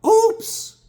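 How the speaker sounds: high, loud mock girly oops; imitating the careless deli girl, squeaky and high; singsong high oops from the deli girl who nearly ran him down; ditzy oops. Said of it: "She just goes".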